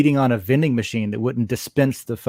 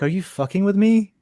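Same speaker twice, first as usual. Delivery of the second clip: higher-pitched mock disbelief; can't believe it, asking if it's a put-on; incredulous; 8 semitones higher than usual. Man imagining his reaction to his friend's mannerisms, incredulous. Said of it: "I would be like".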